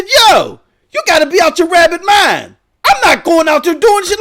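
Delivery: loud, high-pitched retold protest; indignant refusal. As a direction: act out arguing back at a wife. Acted quarrel with his wife.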